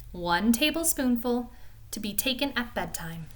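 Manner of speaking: a gentle read-aloud storytelling voice; storybook narration. A narrator's reading. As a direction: read it like a bedtime story for children. Reads Peter Rabbit aloud.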